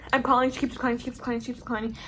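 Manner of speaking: normal talking voice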